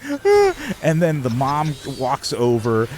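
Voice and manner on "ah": high, loud imitated sob; mock crying wail. Tween told she should be happy, high mock wail. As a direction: wail like a crying kid, hammed-up. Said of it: "she just started fucking crying".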